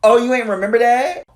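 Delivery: mock gasp, then the jab; sarcastic callout. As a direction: pounce with sassy fake shock. Sarcastic callout of rioting fans.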